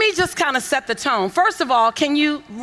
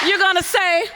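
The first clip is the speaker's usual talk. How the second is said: "You're gonna say" called out louder, as a shout; louder repeat; 3 semitones higher than usual. Shown as a demonstration. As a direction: throw "gonna say" out to the hall. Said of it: "surprise yourself with how loud you can say".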